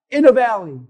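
ordinary delivery

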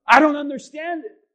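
high, loud outburst; anguished protest. Acted. Preacher as Mary kneeling before Jesus, loud protest. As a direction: throw the words up at God.

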